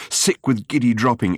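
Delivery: ordinary delivery, nothing marked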